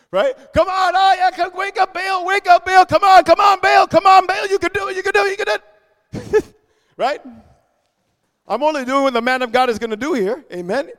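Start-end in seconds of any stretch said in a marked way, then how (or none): 0.5-6.5 high-pitched mock pleading to a false god. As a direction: cheer on an idol with rapid, repeated taunts. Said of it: "He's gonna mock them".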